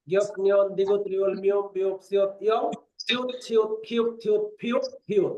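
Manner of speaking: clearly spelled-out letter names, one by one; letter names demo. Shown as a demonstration. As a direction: recite each Lao letter name distinctly. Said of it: "এইভাবে". (বর্ণের নাম স্পষ্ট করে উচ্চারণ)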